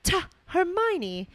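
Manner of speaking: high, slow, drawn-out Ron voice; slow aghast Ron. Acted. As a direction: gasp out the name, offended.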